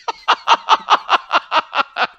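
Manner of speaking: long, high cackle in rapid bursts; out-of-control sadistic laugh. Acted. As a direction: cackle like a mad villain. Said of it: "you've got this laugh that's just out of control".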